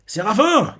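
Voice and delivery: retold shout at the seraphim; mock shout. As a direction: yell it to stop them. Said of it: "Il a crié dessus".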